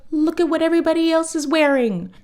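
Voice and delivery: urging. Retold or imitated. Arthur urging Prunella.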